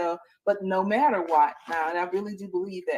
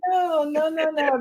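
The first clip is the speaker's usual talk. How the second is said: laughing no no; 10 semitones higher, 5 dB louder, slower than usual. Real, not acted. Laughing reassurance of crying guest.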